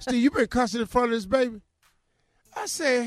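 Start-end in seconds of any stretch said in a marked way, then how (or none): 0.0-1.6 wife scolding. Retold or imitated. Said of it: "she said".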